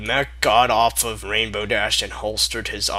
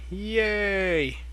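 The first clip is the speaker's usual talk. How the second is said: sarcastic cheer; 8 semitones higher, 5 dB quieter than usual. Real, not acted. Mock cheer at the fight.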